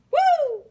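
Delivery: high, loud joyful whoop; a loud, delighted cheer of joy; excited whoop. Real, not acted. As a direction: let out a big happy woo. Excited cheer over food.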